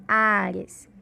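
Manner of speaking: "áreas" slowed, weight on the opening "á"; stress on A. Shown as a demonstration. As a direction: stretch the first vowel of "áreas". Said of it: "É a sílaba A".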